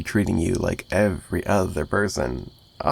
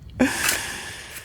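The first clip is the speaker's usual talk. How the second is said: soft voiceless laugh; breathy laugh; 4 dB quieter than usual. Acted. Captor savoring old kills to his captive, voiceless soft laugh. Villain relishing memories.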